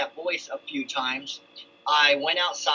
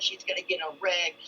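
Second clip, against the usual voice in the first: hushed retold warning; 4 semitones higher, 4 dB quieter, faster than usual. Repeating the voice's warning.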